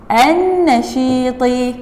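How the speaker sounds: النشيطي drawn out, lam silent, nun doubled; careful pronunciation. Shown as a demonstration. Teacher repeating النشيطي slowly for pupils. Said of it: "لا تلفظ اللام".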